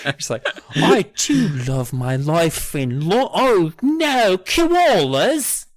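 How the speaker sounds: high, loud mock British-to-Australian accent; British turning Australian. Mocking co-host's British characters deported to Australia, sliding accent. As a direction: start British, slide into hammed-up Australian. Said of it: "all of your British characters start off British".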